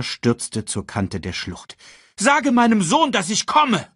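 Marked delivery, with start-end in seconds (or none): 2.2-3.9 high, loud desperate order; urgent shout. A narrator's reading. Mufasa sends word to his son, shouting urgently. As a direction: call after someone flying away. Father shouts after the bird.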